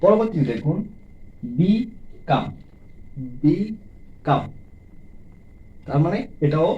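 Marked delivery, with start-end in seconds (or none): 1.6-2.4 "become" as rising বি, pause, falling কাম; syllable by syllable. Shown as a demonstration. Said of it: "ডাই syllable". 3.4-4.4 "become" slowly, বি rising, gap, কাম; two separate beats in become; syllable by syllable. Shown as a demonstration. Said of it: "ডাই syllable".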